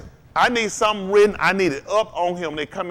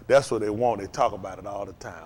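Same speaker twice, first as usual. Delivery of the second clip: ordinary delivery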